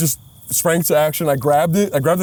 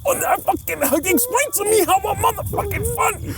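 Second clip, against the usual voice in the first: high, strained movie-gangster tirade; mock outrage. Gangster demanding explanation in Goodfellas bit, mock outrage. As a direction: parody an offended wiseguy demanding answers.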